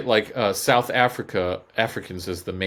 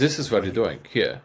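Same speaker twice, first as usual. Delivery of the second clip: put-on blunt, no-nonsense German accent; mock-German directness. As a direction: get to the point as a stern German. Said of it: "Extremely direct".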